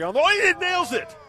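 shouted high excited play-by-play; excited call. Acted. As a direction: explode with the big moment. Calling a big shot live.

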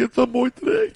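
mock choking up, pitch climbing; emotional. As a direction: voice rising into a put-on sob. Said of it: "I get emotional thinking".

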